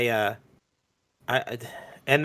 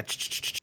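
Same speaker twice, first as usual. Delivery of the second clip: rapid "ch-ch-ch" noise; imitated chattering; 13 dB quieter than usual. Showing the noise the stork made, imitated chatter. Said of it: "A chatter?".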